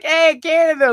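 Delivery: loud, high-pitched holler; hearty mock shout from the street; calling out. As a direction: imitate a passerby calling out to a tourist. Acting out passersby calling out comments.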